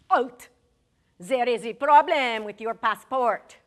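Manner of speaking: put-on loud French-accented halt; stern French official. French passport officer stopping Larry, loud French-accented order. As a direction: bark it like a border guard.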